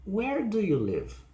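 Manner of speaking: big downward slide ending on "live"; falling question. Shown as a demonstration. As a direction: stress "Where" and fall to "live". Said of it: "is on the first part of the sentence".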